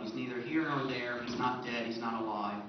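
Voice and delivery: normal talking voice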